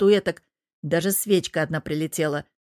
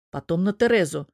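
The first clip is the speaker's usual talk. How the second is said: plain reading voice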